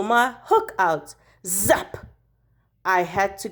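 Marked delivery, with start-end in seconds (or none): none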